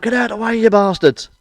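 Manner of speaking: high-pitched mock yell at the traffic; retold shouting. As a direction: bark it like a fed-up driver. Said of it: "out of breath from shouting out".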